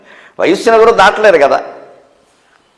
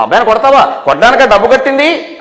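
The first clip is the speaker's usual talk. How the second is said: higher-pitched mock protest, rising at the end; indignant parent; 6 semitones higher than usual. Parent confronting teacher over a beaten boy, mock indignation. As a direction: challenge the teacher with an acted rising question.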